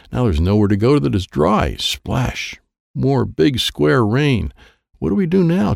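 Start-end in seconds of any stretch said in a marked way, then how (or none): 5.0-5.7 high, panicked yell. Acted. Chad yelling to friends under the square rain, panicked. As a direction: shout it in alarm. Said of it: "Chad yelled".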